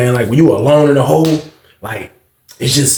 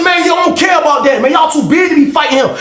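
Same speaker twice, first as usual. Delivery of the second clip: heated argument; 12 semitones higher than usual. Retold or imitated. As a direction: argue hard through the cell door. Arguing back, shouting.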